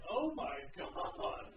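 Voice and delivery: slow, drawn-out, mildly awed; a hint of amazed shoppers; mock amazement. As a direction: stretch it out in light wonder. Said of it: "and they're like".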